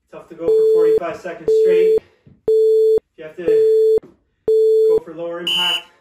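loud fitness coach call; coach shouting. Real, not acted. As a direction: shout it over the music. Coach shouting over workout.

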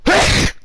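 real loud sneeze; sudden explosive sneeze. Real, not acted. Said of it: "Oh, I sneezed".

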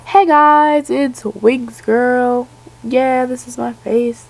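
high, loud, slow mock intro; hammed-up youtuber greeting. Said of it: "started every video like".

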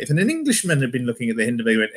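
usual speaking voice

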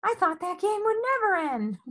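Mom. A narrator's reading. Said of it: "said Mom".

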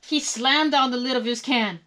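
usual speaking voice

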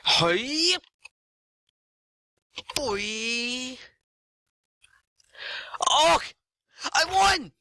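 thrilled yelling; excited shouts. Real, not acted. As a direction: whoop at the win.

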